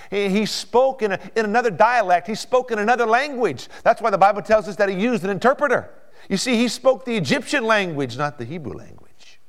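high, loud, insistent preaching; full-force preaching. Real, not acted. Preacher explaining Joseph's foreign language, full-force preaching. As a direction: drive the explanation home from the pulpit.

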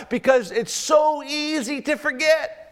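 high, slow preacher's shout; shouted sermon peak. Acted. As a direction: stretch it out and shout it to the room.

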